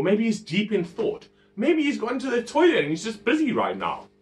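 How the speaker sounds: high-pitched mocking taunt; retold jeer, pitched well up; Elijah. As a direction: tease them with fake helpful suggestions. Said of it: "Elijah starts taunting them".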